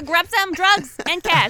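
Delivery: loud, high shouted command; loud bossy yell to the robot; yelled order. Acted. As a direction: bark it at the robot servant. Yelling orders at robot Lanesha.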